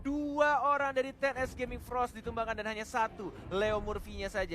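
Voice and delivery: high, loud excited play-by-play; fighting game announcer. Acted. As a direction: build the call to a peak. Caster calls a teamfight.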